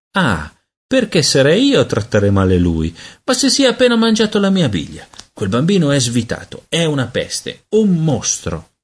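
put-on high, exasperated boy's outburst; indignant rant. A narrator's reading. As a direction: burst into a high protest, slowing on the last insult. Peter rants in quarrel with sister.

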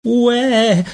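put-on very high baby wail; gridato. A narrator's reading. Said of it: "le gridò lui". (grido acutissimo da neonato)

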